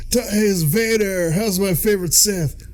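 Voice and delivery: high, put-on phone-chat voice; parody caller. Emperor greeting Vader on a call, mock chummy. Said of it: "He gets a call from Darth Vader".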